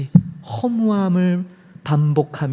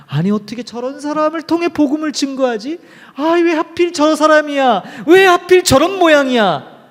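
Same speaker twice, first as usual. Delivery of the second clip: rising, exasperated mock grumble, higher pitched; incredulous complaint; 9 semitones higher than usual. Pastor mimicking doubting believers, exasperated. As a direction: voice the church folks' disbelief.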